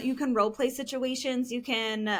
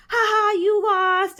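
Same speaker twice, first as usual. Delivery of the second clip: loud, slow mocking "ha ha"; hammed-up sore winner jeering; gloating taunt; 8 semitones higher, 8 dB louder, slower than usual. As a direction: rub the loss in their face. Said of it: "you just started screaming about".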